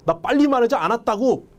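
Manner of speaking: high-pitched, indignant protest; angry denial. Acted. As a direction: act annoyed at being wrongly accused. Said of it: "화가 나서 그러는 거예요". (화나서 항변하는 말투)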